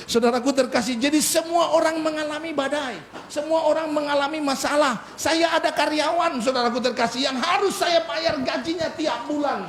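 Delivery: high-pitched preacher's delivery; impassioned preaching. Acted. (khotbah berapi-api bernada tinggi)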